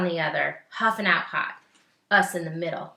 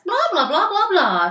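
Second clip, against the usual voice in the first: much higher, louder mock blah blah; chattering Mama; 11 semitones higher, 10 dB louder than usual. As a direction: gush it like a chatty mother. Mama's chatter voiced.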